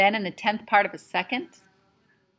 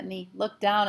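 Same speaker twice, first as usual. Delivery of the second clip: ordinary delivery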